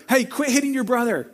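high-pitched telling-off; mock parental scolding. Parent to child hitting a brother, mock scolding.

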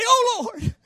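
high, urgent call upward; panicked cry. Acted. Preacher showing his own prayer while sinking, panicked cry. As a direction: call out as if the water is rising. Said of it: "But I'd say".

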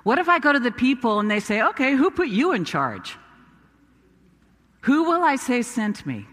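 pressing the doubts in protest; arguing. Acted. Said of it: "But I kept arguing".

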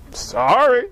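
a second grudging try at meaning it; a kid told to mean it, still half-hearted; still half-hearted sorry. Retold or imitated. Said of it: "go say it like you mean it".

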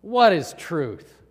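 loud, slow, cynical question. Acted. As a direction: throw it out as a cynic's dismissal. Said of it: "he was cynical".

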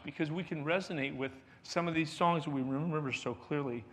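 normal talking voice

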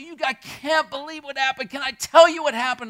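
high, loud, eager burst; can hardly contain the news; excited. Retold or imitated. Excited friend bursting to share an accomplishment, high and loud.

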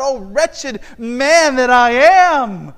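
slow, loud anguished cry. Acted. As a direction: cry it out like Paul in despair. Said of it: "he cries out".

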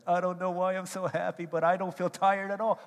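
high and loud, bursting with happiness; giddy excitement. Retold or imitated. Lovesick friend telling buddy he's not tired, giddy and loud. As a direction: play the sleepless guy who can't stop grinning. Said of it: "He's like".

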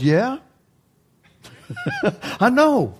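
light, laughing voice of God; knowing, amused reply; God answering, amused. Retold or imitated. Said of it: "He goes".

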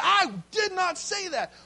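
loud, high, outraged denial; indignant shout. Retold or imitated. Boy denying sister's lie.

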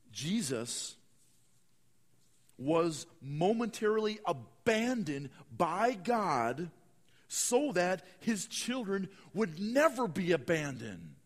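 slow preacher's proclamation; preaching emphasis. Acted. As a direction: proclaim it slowly to the congregation. Preaching key statement.

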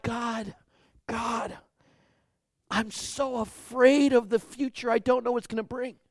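a touch of a frightened pray-er; fearful prayer. Acted. Believer pleading with God, lightly voiced fear. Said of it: "When we say to God".

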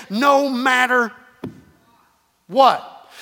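NO MATTER drawn out, long pause, punched WHAT; slow "No matter", then a firm "what"; emphatic. Shown as a demonstration. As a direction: hold back before landing on "what". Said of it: "it's kinda emphatic".

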